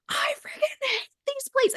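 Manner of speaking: hissed, high whispered outburst; a husband finally cracking, in a strained whisper; mock snapping husband. Husband snapping at his wife over lunch plates, mock rage whisper. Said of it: "he just snaps and he's like".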